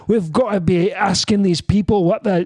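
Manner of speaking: put-on speechify in a Scottish accent. Comic mimics eloquent Scot, thick Scottish accent. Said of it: "that's a good Scottish accent".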